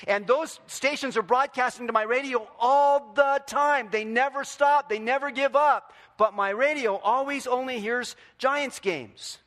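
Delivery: full-force pulpit passion; fired-up preaching. Acted. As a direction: preach it hard, fast and urgent.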